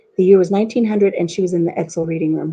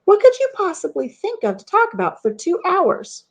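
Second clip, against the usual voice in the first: imitating a husband asking in high mock disbelief; husband incredulous; 9 semitones higher than usual. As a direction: act out the husband's amazement. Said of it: "He goes".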